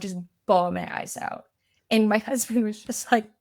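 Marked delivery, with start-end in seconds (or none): none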